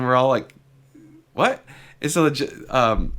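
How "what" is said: a sharp, much higher what; stunned what. Retold or imitated. Court reacting to the plaintiff, stunned high what. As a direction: blurt it out in disbelief.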